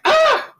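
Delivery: very high, loud startled scream; shrill scream. Retold or imitated. Homer seeing the colonel outside, shrill scream. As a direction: shriek in sudden terror.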